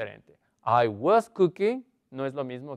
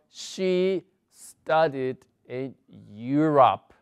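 slow, deliberate English example; slow model reading; much slower than usual. Shown as a demonstration. Teacher modelling the past simple slowly for students. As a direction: spell it out slowly for the class. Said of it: "teacher models past simple".

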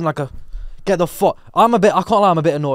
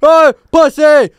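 high, drawn-out shout; imitated jeering call; mock taunting yell; 10 semitones higher, 10 dB louder, much slower than usual. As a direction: holler an insult across a shop. The man taunts again.

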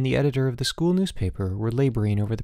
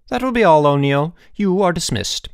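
loud, raised-pitch headmaster's order; forceful dismissal; 8 semitones higher, 9 dB louder than usual. A narrator's reading. Headmaster dismisses the punished student, loud and commanding. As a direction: send the boy out with ringing authority.

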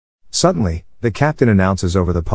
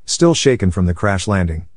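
plain reading voice

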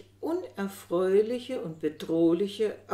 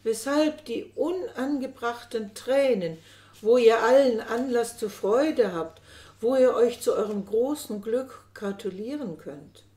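higher and louder, gushing cheer; louder effusive coaxing; exuberant; 4 semitones higher, 7 dB louder than usual. A narrator's reading. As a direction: gush over them like a doting old nurse. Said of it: "rief sie überschwänglich".

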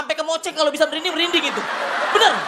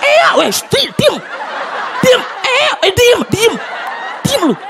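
much higher, louder mock scolding; scolding mother; 10 semitones higher, 6 dB louder, slower than usual. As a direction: snap at the crying baby to hush.

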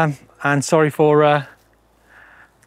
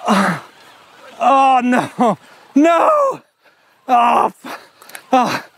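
panicked; 6 semitones higher, much slower than usual. Real, not acted. Losing control on steep slope.

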